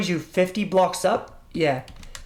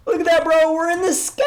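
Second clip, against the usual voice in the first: excited; 10 semitones higher, 4 dB louder, faster than usual. Real, not acted. Excited reveal of flying.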